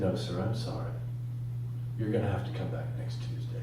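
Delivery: low, soft, final refusal; apologetic refusal. Acted. Hospital worker refusing, acted.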